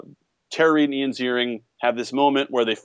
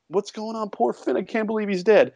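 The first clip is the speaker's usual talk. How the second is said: mock shock; 8 semitones higher than usual. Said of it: "And so you're like".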